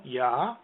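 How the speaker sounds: Yah with an open ah vowel; A-vowel demo. Shown as a demonstration. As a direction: sound out Yah as a lone syllable. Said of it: "definitely an A vowel".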